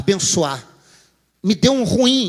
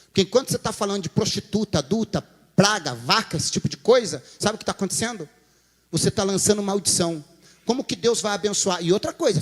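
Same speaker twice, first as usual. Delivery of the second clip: usual speaking voice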